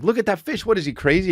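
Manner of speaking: put-on high excited kid-talk; talking to a toddler. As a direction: point it out to a little kid.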